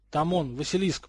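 ordinary delivery, nothing marked

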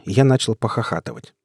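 plain reading voice

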